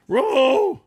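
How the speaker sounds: high, loud dog-talk yell; terrified cartoon dog shout; Scooby shout. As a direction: bark out the warning like Scooby. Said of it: "Scooby cried".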